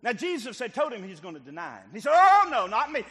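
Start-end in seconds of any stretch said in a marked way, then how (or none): 2.1-3.0 sudden loud high cry of denial; mock protest. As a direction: Peter waving it off, loud and high. Peter protesting he won't deny.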